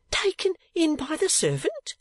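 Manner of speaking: put-on high, startled old man; shocked disbelief. A narrator's reading. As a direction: gasp at the news. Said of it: "cried Newman Noggs".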